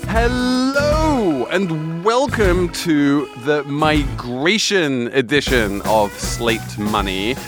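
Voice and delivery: slow, drawn-out welcome; radio host intro. Acted. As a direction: open the show like a radio host. Show opening over music.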